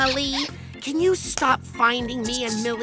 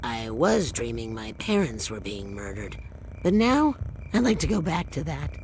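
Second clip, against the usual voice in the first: very low, subdued deadpan; low, soft, fed-up monotone; weary deadpan; 13 semitones lower, 3 dB quieter than usual. Acted. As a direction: deliver it dryly, sunk low in the voice. Woken, wishing for the nightmare.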